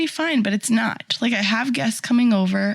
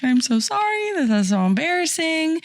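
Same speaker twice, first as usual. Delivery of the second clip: high, gushing apology, pitched way up; people-pleaser apology; 6 semitones higher than usual. Retold or imitated. Hostess apologizing to her guest for the messy house, high and gushing. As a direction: overapologize sweetly, voice jumping high. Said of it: "I am a people pleaser".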